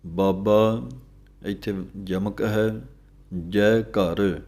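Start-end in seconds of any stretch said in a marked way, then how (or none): none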